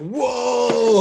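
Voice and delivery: high, loud hammed-up whoa; mock awe. Imagining a band facing the singer's open jaw, mock stunned. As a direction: belt it out as if blown away.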